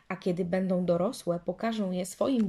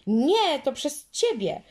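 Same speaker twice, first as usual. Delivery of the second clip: higher, louder angry denial; angry girl, high and loud; 7 semitones higher, 8 dB louder, slower than usual. A narrator's reading. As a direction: throw the blame back hard. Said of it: "rozzłościła się Marysia".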